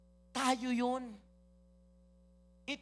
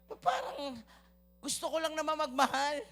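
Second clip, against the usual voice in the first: pleading believer; 6 semitones higher, 5 dB louder than usual. Acted. Pastor voices a longing believer.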